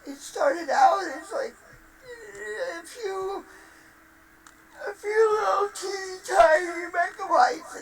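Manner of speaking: hammed-up crying complaint, voice breaking and cracking high; fake-cry wailing at Microshaft; mock sobbing.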